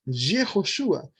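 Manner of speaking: Yehoshua said slowly, syllable by syllable; careful pronunciation. Shown as a demonstration. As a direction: model Yehoshua slowly for students. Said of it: "Esa es su pronunciación gramatical en hebreo". (Yehoshua pronunciado despacio)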